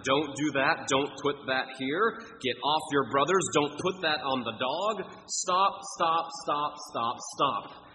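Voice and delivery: high, loud nagging parent repeating stop; exasperated parent. Retold or imitated. Parent telling kids to stop, mock exasperated scolding. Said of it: "You say it all the time".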